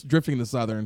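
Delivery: usual speaking voice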